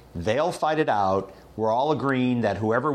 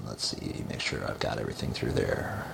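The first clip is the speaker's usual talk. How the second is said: whispered aside; 12 semitones lower, 8 dB quieter than usual. Real, not acted. Muttering to himself checking notes.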